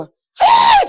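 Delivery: sudden high furious yell; a burst of rage before the insults; angry shout. Retold or imitated. Parent yelling at a clumsy child, furious cry.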